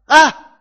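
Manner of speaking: loud, high "Ah!"; sudden cry. Acted. Sudden cry while describing the cross.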